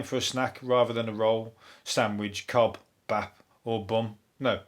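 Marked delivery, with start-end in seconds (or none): none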